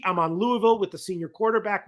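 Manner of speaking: usual speaking voice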